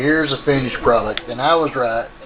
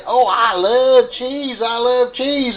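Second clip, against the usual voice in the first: gushing cheese lover; 7 semitones higher, slower than usual. Retold or imitated. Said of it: "people I talk to say".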